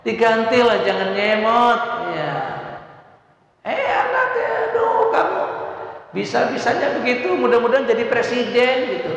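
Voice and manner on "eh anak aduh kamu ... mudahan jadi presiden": put-on much higher, gushing praise for the child; exaggerated fond parent exclaiming; high excited blessing. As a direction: gush over the kid in a high voice.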